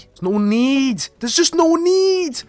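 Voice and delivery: drawn-out disbelieving whine; exasperated. Real, not acted. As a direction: plead in disbelief. Exasperated at zombie swarm.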